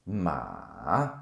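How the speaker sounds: drawn-out "Ma" dipping low, then rising; third tone, dipping. Shown as a demonstration. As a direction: overpronounce "Ma" down through a valley and up. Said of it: "then it goes up again".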